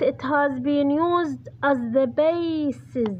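very slow, word by word, for writing down; slow dictation. Shown as a demonstration. Teacher dictating the answer to her students, slow and deliberate. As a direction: spell it out slowly so students can copy.

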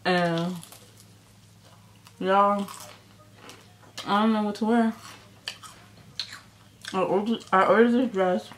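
usual speaking voice

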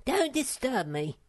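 high little creature voice, mildly solemn; self-important small animal. Acted. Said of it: "said Sniff solemnly".

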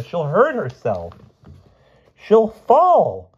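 high, drawn-out frightened wail. A narrator's reading. Martha fearing her mother will fall, wailing. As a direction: build to a loud panicked cry. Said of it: "wailed Martha".

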